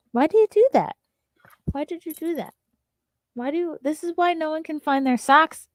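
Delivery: playful high scolding of the pup; mock scolding the dog. Real, not acted. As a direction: tease the dog in a high pet voice.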